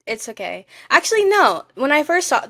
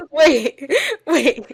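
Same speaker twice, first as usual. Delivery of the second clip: laughing; 6 semitones higher, 5 dB louder than usual. Retold or imitated. Retelling alarm, laughing.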